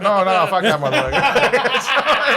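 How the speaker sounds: loud, slow laughing no; laughing refusal. Real, not acted. Laughing and refusing the man loudly.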